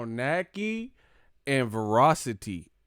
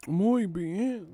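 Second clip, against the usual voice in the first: high, hammed-up Spanish-flavoured praise; mock Spanish gourmet voice; mock Spanish relish; 10 semitones higher, slower than usual.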